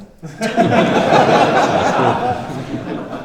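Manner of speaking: breathy burst of laughter; audience-facing laugh. Real, not acted. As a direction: crack up helplessly.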